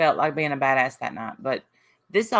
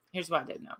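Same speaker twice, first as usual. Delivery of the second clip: softer pointed repeat; quiet repeat; 11 dB quieter, faster than usual. Real, not acted. To chat, repeating the point in a much softer voice. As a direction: drop the volume and press it home.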